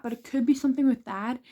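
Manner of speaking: ordinary talk, nothing marked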